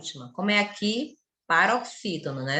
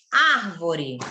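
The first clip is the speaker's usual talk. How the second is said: "árvore" with a long, strong first AR; teaching demo, weight on AR in "árvore"; stress on AR; 4 semitones lower than usual. Shown as a demonstration. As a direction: stretch the first syllable of "árvore", let the rest fall. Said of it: "A sílaba tônica é a antepenúltima".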